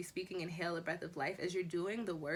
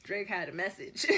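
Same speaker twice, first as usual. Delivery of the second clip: normal talking voice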